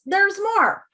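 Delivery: high, swooping sales-pitch reveal; salesman voice. Pretend. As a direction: announce the bonus like a salesman. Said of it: "she said in her salesman voice".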